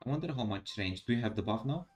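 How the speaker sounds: normal talking voice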